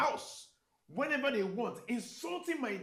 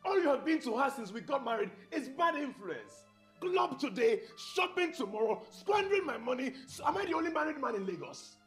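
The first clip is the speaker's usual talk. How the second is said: raised, higher-pitched tirade; angry rant; 7 semitones higher than usual. Acted. Husband fed up with wife's friends and spending, angry rant. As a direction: build from complaint to exasperated outburst.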